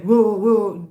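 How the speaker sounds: a loud, sudden "whoa, whoa"; loud-mouth demo. Shown as a demonstration. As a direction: show how loud you can get at work. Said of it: "this loud mouth".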